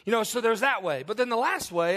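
usual speaking voice

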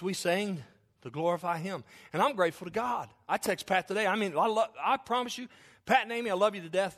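usual speaking voice

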